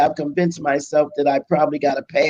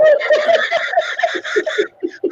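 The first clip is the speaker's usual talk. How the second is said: bursting into a high, real laugh. Real, not acted. Laughing at her own story.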